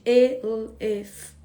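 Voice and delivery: drawn out elefante; slow syllables. Shown as a demonstration. Teacher stretching elefante for the class. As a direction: say elefante very slowly. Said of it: "eu dei o exemplo do elefante".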